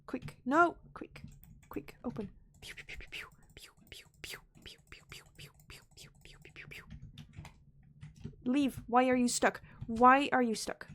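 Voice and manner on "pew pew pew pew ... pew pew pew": very quiet whispered pew-pews; rapid, tiny whispered zaps; imitated pew noises. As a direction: whisper a run of tiny laser sounds.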